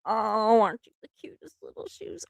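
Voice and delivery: put-on soft, breathy coo to baby shoes; baby-talk whisper. In the store cooing to little baby shoes. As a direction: whisper sweetly over tiny shoes.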